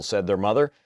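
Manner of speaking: usual speaking voice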